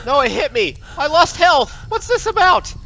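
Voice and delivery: high, loud aggrieved protest; alarmed indignation. Real, not acted. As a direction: protest shrilly at being hit. Hurt in game.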